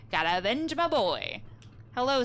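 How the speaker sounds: normal talking voice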